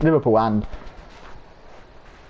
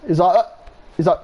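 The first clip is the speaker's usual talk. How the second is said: normal talking voice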